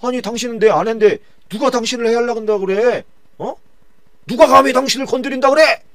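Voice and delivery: high, loud outraged question; king furious that someone threatens his queen; indignant king. Acted. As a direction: demand who dares touch her. King angry for his wife.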